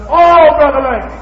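high, loud, drawn-out "Oh"; mock plea. Preacher imitating an aimless pleading prayer. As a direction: wail the name up high. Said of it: "saying nothing but".

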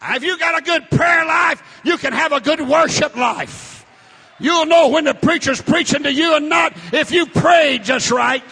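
loud, high preacher's cry; full-force revival preaching; fiery preaching. Real, not acted. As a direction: preach it hard to the congregation.

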